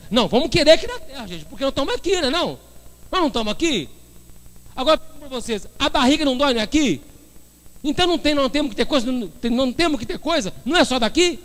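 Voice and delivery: high-pitched, insistent mock objection; acted protest. People wanting everything here on earth, acted protest.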